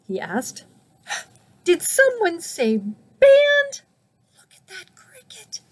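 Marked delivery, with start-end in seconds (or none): 1.6-3.8 very high, drawn-out squeak; excited cricket. A narrator's reading. Cricket pops out of the grass to ask Snake, excited high squeak. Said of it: "Cricket popped out of the grass". 4.3-5.6 hushed, barely-there whisper; whispered aside. Real, not acted. Reader points out the cricket to listeners, whispered aside.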